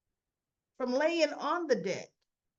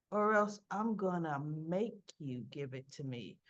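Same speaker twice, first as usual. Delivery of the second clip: low, softer, deliberate threat; imitating a menacing younger brother; retold threat; 7 semitones lower, 5 dB quieter than usual. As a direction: threaten in a lowered voice. Younger brother threatens older one.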